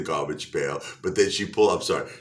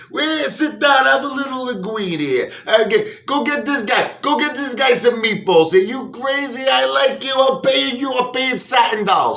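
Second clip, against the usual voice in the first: amused gangsters; 6 dB louder than usual. Retold or imitated. Mafia guys delighted by Pryor, high mock mobster voice. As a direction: gush like a grinning wise guy. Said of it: "mafia guys".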